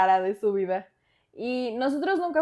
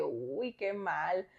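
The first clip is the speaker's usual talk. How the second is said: lower, flat-toned uninterested dad; dad unimpressed; 4 semitones lower, 8 dB quieter, much slower than usual. Retold or imitated. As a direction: shrug it off, unbothered. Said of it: "me dijo así de que". (papá desinteresado, más grave)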